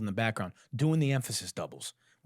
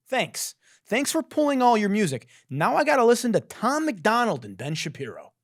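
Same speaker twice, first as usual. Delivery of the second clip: high, louder exasperated thanks; sarcastic outrage; 8 semitones higher, 4 dB louder than usual. Real, not acted. Listener blaming label for pulled music, sarcastic outrage. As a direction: complain with bitter mock gratitude.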